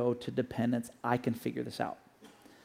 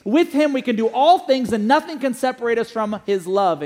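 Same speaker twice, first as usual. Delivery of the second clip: higher, louder preaching proclamation; loud, lifted sermon cadence; full-force preaching; 7 semitones higher, 8 dB louder than usual. Acted. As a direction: proclaim it like a declaration of faith.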